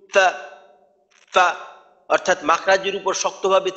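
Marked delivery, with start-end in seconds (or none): 0.1-1.5 সা pressed hard at the lips; সা forced out with strong pressure. Shown as a demonstration. Teacher showing students the wrong, overly hard fa. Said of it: "যদি শক্তভাবে বলি".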